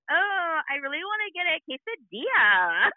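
put-on high, swooping Valley girl whine. Mom mimicking daughter's Spanish, hammed-up Valley girl accent. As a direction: exaggerate the Valley girl lilt. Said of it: "You speak with a Valley girl accent".

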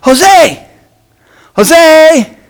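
high, loud call across the field; calling out a name from second base; yelling a name. Retold or imitated. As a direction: shout his name to get his attention. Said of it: "he yells out".

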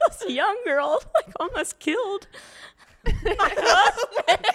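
quoting the wife through giggles; laughing retelling. Retold or imitated. Retelling the yelling wife, laughing through it.

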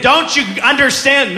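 high, pleading exasperation; exasperated dad. Acted. Impatient dad voiced in story.

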